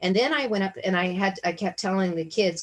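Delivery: ordinary talk, nothing marked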